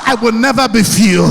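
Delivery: loud chant-like vow for the crowd to repeat; shouted affirmation. Shown as a demonstration. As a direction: lead the hall in a rallying vow.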